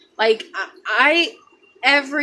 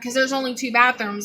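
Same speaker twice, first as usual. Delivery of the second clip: ordinary delivery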